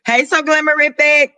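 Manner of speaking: ordinary delivery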